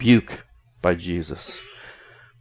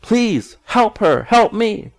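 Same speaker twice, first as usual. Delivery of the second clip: much higher, slow, pleading and exasperated; agitated plea for help; 11 semitones higher, slower than usual. Retold or imitated. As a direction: beg someone to step in. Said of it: "we get agitated".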